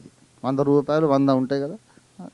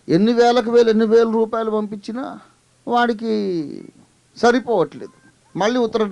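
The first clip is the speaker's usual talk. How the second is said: fiery preaching; 9 semitones higher than usual. Acted. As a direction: hammer home the waste of money. Preaching at full force.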